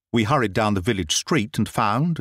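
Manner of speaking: usual speaking voice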